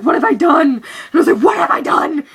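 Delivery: loud, strained mock panic; panicking. Reliving a blunder with a friend, panicking loudly. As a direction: wail it in retold panic. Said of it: "I was, like, panicking".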